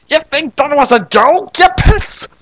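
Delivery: put-on high indignant girl telling off the creature; angry girl snap. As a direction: bite back at the smug creature. Angry Sayaka retort.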